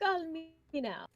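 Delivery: high, slow TV psychic impression; Miss Cleo. Game master repeats the old impression, high and drawn out. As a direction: stretch out the hotline catchphrase, pitched high. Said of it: "used to do the Miss Cleo voice".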